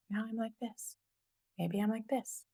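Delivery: hushed, very soft musing; quiet, as if thinking aloud; whispering. Real, not acted. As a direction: drop right down to a near-whisper. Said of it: "I don't know why I'm whispering".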